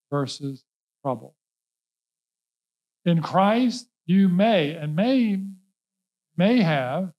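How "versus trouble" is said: ordinary talk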